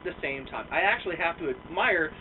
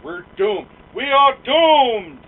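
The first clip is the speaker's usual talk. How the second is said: slow, loud, hammed-up doom; hammed-up prophecy of doom; mock doom prophet; 7 semitones higher, 13 dB louder, much slower than usual. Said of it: "How do you say".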